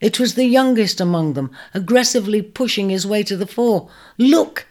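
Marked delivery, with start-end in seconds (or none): 4.2-4.7 high, louder rising cry; excited cry. A narrator's reading. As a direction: point and shout with wonder. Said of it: "he cried".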